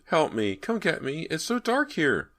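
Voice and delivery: put-on high child's voice, slowing on the dark; pleading little boy. A narrator's reading. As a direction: beg to be fetched from the dark. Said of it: "the voice pleaded".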